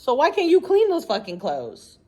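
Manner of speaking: normal talking voice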